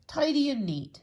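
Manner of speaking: pleased-with-himself tidy man; proud Mister Tidy. Acted. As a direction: announce it proudly. Character catchphrase.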